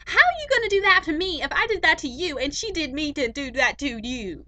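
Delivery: high, loud, far-off hammed-up squabbling guest; mock guest rant. Teen vlogger mimics a talk-show guest, shrill hammed-up rant. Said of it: "So, it was like this".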